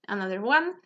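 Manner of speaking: ordinary delivery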